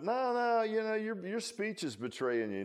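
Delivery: high, insistent accusation; pointing accuser. Acted. Bystander accusing Peter, high and insistent. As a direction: catch him out by his accent.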